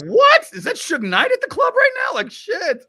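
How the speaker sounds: high, loud disbelieving yell; over-the-top starstruck shout; shocked shout. Pretend. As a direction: shriek it like you just spotted a celebrity. Imagined reaction to seeing Suge Knight.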